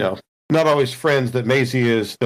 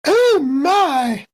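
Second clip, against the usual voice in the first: put-on high, fluttery old-lady exclamation; prim old lady; 13 semitones higher than usual. [name] reacting to the android reveal, prim old lady. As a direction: gasp it like a proper elderly lady.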